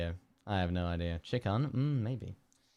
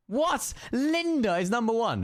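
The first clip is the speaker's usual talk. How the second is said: shocked outburst; 13 semitones higher, 4 dB louder than usual. Real, not acted. Shocked by the guess result.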